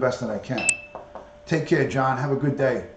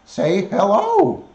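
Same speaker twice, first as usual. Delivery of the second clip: high, slow and louder sing-song; coaxing pet voice; 7 semitones higher, 6 dB louder, much slower than usual. Acted. Coaxing the parrot to greet viewers, slow high sing-song. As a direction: stretch it out to coax the bird.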